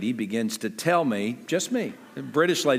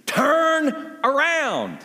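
much higher, slow stretched order; drawn-out GPS command; 12 semitones higher, 3 dB louder, much slower than usual. Retold or imitated. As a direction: drag it out like a weary sat-nav. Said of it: "She says".

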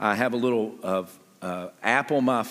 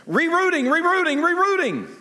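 much higher, louder robotic repeats; mock GPS rerouting; 4 dB louder than usual. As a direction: chant it like a frantic sat-nav. GPS recalculating route.

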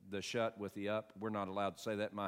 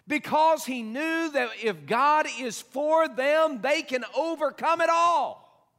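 high, loud, slow rallying cry; full-force preaching; 12 semitones higher, 11 dB louder, slower than usual. Acted. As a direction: preach it like a battle call. Preaching Caleb's bold call.

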